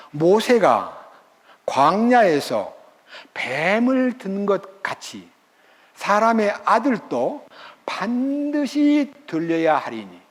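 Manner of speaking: reads the verse slowly, word by word; slow scripture reading. Shown as a demonstration. Preacher reading the verse to the congregation, slow and deliberate. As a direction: lets each phrase of scripture sink in. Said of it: "삼 장 십사 절입니다".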